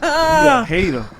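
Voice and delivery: laughing. Real, not acted. Laughing at the hater comeback.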